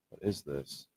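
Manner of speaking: normal talking voice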